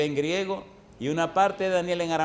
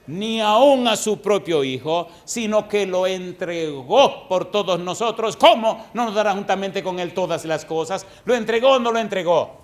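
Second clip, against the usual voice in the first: slow, ringing declamation of a verse; forceful scripture preaching; 3 semitones higher, 5 dB louder than usual. Real, not acted. Preacher proclaiming Romans to the congregation, slow and forceful. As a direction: proclaim it phrase by phrase, then press the question.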